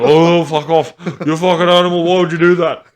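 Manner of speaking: loud, high disgusted outburst; imitating a furious, disgusted father; disgusted dad yelling. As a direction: yell it like a gagging dad hit by the smell. Dad gagging at fart, acted.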